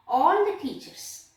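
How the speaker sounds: usual speaking voice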